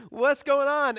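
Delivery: high, loud disbelief; baffled parent. Retold or imitated. Dad confronts half-dressed son.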